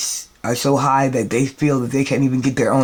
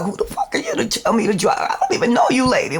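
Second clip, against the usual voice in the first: fast, higher, indignant comeback; outraged retort; 8 semitones higher, faster than usual. Retold or imitated. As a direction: rattle it off in fury. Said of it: "I'm like".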